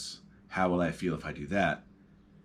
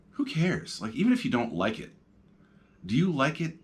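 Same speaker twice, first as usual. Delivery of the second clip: ordinary delivery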